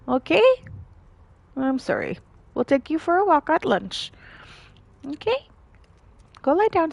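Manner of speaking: high, coaxing voice; apologetic pet talk. Real, not acted. Owner apologises to dog about the walk, high coaxing voice.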